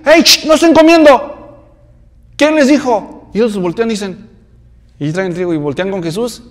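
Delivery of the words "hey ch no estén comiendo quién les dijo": higher, louder scolding yell; yelling Pharisee. Acted. Pharisees yell at the disciples eating grain, higher scolding yell. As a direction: snap at them to stop eating.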